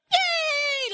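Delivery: put-on high squeal of a small child; childlike cheer. The nation cheering the new PM, childlike high yay. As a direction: cheer like a delighted little kid. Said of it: "like the small children".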